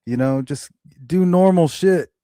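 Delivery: hammed-up husband telling her to get back to work; mock husband. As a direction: bark the advice like a clueless husband. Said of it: "He's like".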